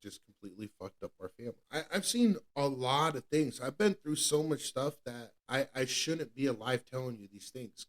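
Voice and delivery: usual speaking voice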